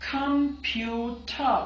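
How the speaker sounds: clear drill words. Shown as a demonstration. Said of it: "मेरे साथ repeat कीजिएगा".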